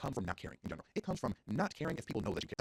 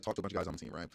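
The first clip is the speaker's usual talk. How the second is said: ordinary talk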